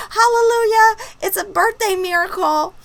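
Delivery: high-pitched hallelujah of relief; mock rejoicing. Retelling her birthday relief at leaving, high mock rejoicing. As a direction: celebrate it in a high, playful voice.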